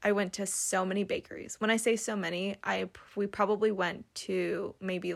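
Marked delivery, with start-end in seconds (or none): none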